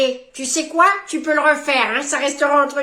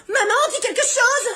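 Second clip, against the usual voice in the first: whiny plea; 9 semitones higher than usual. Acted. Child begging mother to intervene.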